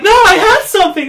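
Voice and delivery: high, loud, fast imitation of a protest; mock indignant shout. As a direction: blurt it out as your co-host's panicked objection. Acts out co-host's protest.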